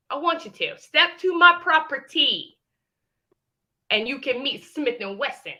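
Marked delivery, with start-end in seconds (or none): 0.1-2.6 taunting dare. Real, not acted. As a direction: dare her to step up. Daring rival to come over.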